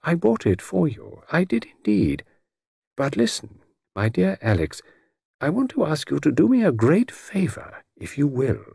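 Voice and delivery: lightly voiced gentleman, swooping high; gallant Mister Satterthwaite, pleading. A narrator's reading. Satterthwaite asks his dear Alex a great favour, gallantly. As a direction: ask the favour with courtly earnestness.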